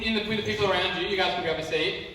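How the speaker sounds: normal talking voice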